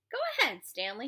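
high, goading encouragement; pushing him into a fight; egging on. A narrator's reading. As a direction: urge him on with a lifted voice. Counselor eggs boy on to fight.

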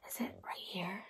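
gasp, then whisper; a breathless whisper to the kids; excited whisper. Acted. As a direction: hush as if you found it. Gasping search for the egg.

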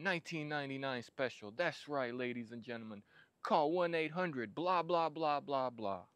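high, upbeat sales pitch; infomercial pitch. Pretend. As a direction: hype it like a late night ad. Mock infomercial pitch opening.

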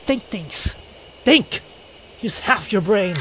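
high, emphatic telling-off; exasperated scolding. Acted. Player to reckless companion, exasperated scolding.

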